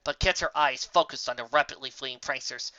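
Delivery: plain reading voice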